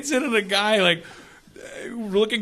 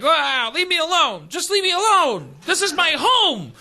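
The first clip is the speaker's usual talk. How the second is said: exasperated father; 7 semitones higher than usual. Retold or imitated. Father fending off reporters.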